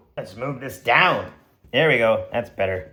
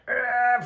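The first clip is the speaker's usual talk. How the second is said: much higher straining grunt; 10 semitones higher than usual. Acted. Prying the pod open, higher straining grunt. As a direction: heave with all your strength. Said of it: "I'm like".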